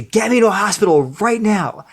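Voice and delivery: mock urgent injured man; urgent demand. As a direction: insist on the hospital at once.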